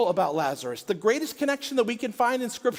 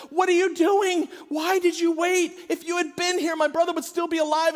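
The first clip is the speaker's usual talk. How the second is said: high, loud, anguished outburst at Jesus; frustrated shout; 9 semitones higher, 5 dB louder than usual. Retold or imitated. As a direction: cry out in a grieving sister's frustration. Martha confronts Jesus, acted quote.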